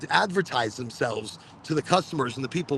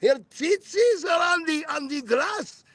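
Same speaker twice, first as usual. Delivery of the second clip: hysterical mother; 13 semitones higher, slower than usual. Retold or imitated. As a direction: shout it like an excitable mother gawking. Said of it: "my mother was always like".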